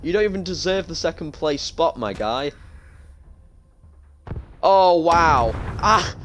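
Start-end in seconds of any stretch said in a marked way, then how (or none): 4.6-6.1 loud surprised gasp. Real, not acted. Shocked reaction to the replay.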